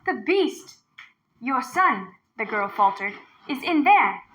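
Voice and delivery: put-on a frightened girl luring the tiger; young girl. A narrator's reading. Girl voice in story.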